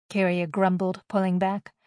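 ordinary delivery

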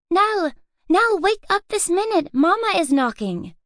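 high, pressing little sister; urgent girl's voice. A narrator's reading. Louise shaking Nell awake, urgent. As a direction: hurry her sister out of bed. Girl urging sleeping sister.

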